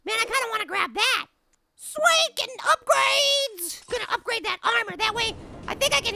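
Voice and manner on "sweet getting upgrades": excited cheer. Real, not acted. Player cheering getting upgrades, excited. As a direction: crow with delight, stretching the last word.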